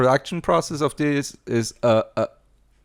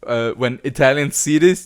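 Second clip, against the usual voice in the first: ordinary delivery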